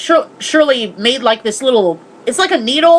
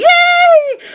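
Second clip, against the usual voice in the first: much higher, louder yay; gleeful cheer; 5 dB louder than usual. Real, not acted. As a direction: cheer in mock triumph. Cheers after spoiling the movie.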